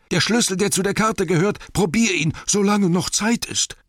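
high, loud urgent yelling; Bilbo. A narrator's reading. Hobbit urging Thorin to try the key, high urgent bellow. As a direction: shout it as if time is running out. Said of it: "brüllte Bilbo".

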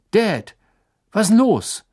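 loud, high call into the dark. A narrator's reading. Son calls to his father through darkness, loud call. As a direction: shout across the campsite. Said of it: "rief er durch die Dunkelheit".

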